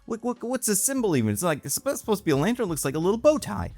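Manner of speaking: mock a snob who finds it all silly; mocking dismissive voice. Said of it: "people going".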